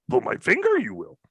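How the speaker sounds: high Yoda croak. Pretend. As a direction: squeeze out the line like Yoda. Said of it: "You didn't like it?".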